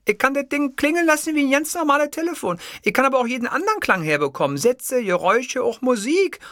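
very high secretary voice. A narrator's reading. Secretary explaining the phone's sounds, high character voice.

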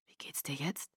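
very soft, low question; hushed concern. Acted. Boyfriend checking on his sick girlfriend, hushed concern. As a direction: ask gently, almost under the breath.

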